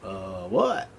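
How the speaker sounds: groggy confused Abner. Retold or imitated. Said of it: "And Abner's like".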